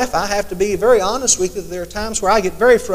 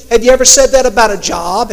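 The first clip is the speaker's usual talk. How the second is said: ordinary talk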